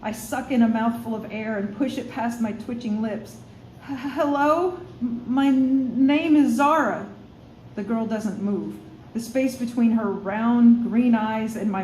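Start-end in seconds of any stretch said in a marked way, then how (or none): 3.9-7.1 stuttering, nervous little greeting; nervous stammer. Acted. Zara stammers her name to the human girl, nervous. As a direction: push the words out past twitching lips.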